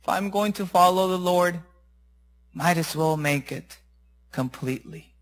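soft, low resolve; resigned self-talk. Acted. Master talking himself into obeying, soft and resigned. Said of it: "he said to himself".

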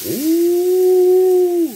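a loud, high squeal of delight; high excited ooh. Real, not acted. Cook thrilled by his steak, loud high squeal.

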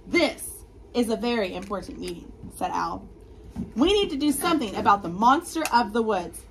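loud, lively read-aloud to the class; reading to children. Acted. Teacher reading a picture book to her class, loud and lively. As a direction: read the story big for the kids.

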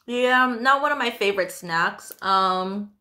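chewing while talking; speaking around food; mouth partly full. Real, not acted. Seen 1: Bit snack at -9, still chewing with grimace while speaking.

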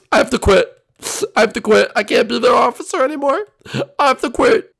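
high, loud anguished wail; distraught resignation. Acted. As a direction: give up in hammed-up despair.

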